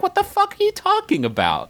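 can't believe what he's hearing; exasperated disbelief. Real, not acted. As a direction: pitch jumps way up in baffled outrage. Baffled rant about the scenario.

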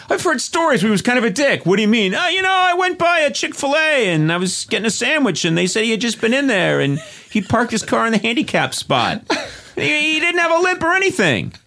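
high, mocking imitation of his co-host; mocking Sona's voice. Mocking his co-host with a high whiny voice. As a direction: whine it back at her in mock falsetto. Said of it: "your Italian accent sucks".

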